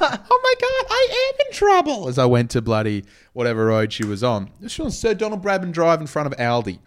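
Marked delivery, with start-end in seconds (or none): 0.0-2.1 damsel in distress. Retold or imitated. Girlfriend voiced in retold story.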